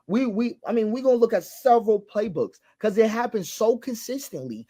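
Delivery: ordinary talk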